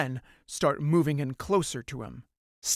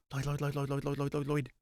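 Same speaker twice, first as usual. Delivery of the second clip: soft, lower rapid repeating mutter; anxious muttering; 3 semitones lower, 8 dB quieter, faster than usual. Acted. Riho ignores Alan, softly muttering Lloyd's name. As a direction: murmur the name over and over to yourself.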